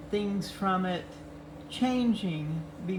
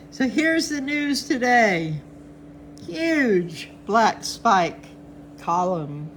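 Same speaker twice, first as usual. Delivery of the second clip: ordinary talk, nothing marked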